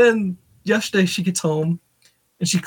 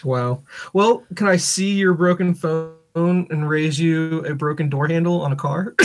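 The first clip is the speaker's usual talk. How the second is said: ordinary talk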